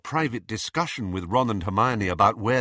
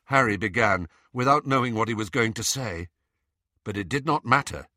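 usual speaking voice

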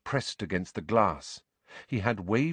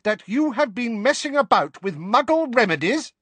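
much higher, louder outraged demand; furious mother; 13 semitones higher, 5 dB louder than usual. A narrator's reading. Mother confronting her husband, high and furious. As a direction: let the fury peak on Muggle. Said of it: "her voice growing louder with every word".

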